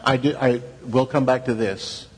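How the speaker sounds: usual speaking voice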